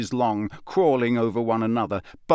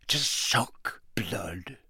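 whisper; 8 dB quieter, much slower than usual. Acted. Ominous end of Hagrid's line.

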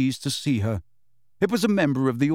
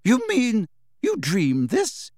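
put-on slow, higher, doubting older woman; skeptical professor; 9 semitones higher, slower than usual. A narrator's reading. McGonagall doubting Harry's dream, skeptical. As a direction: question his story.

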